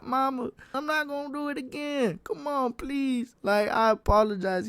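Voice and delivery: high-pitched retold begging; pleading. Son convincing his mother not to tell pops, pleading. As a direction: plead like a kid to his mama.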